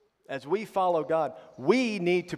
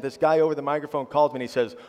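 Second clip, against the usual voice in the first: ordinary delivery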